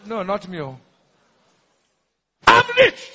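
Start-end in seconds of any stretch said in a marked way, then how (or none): 2.5-3.1 very high, loud faith confession; ringing high-pitched pulpit shout; shouted declaration. Real, not acted. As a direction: cry it out for the crowd to repeat. Leads congregation in shouted declaration.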